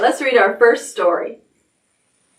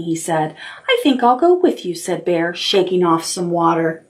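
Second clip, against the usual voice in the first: gentle storybook reading; reading to children; 3 semitones lower than usual. Acted. Teacher reading a picture book to the class, storytelling. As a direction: tell it like bedtime story time.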